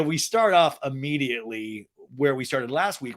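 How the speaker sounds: ordinary talk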